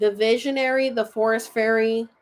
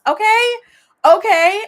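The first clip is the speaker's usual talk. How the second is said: excited; 11 semitones higher, 10 dB louder than usual. Real, not acted. Excited about the card.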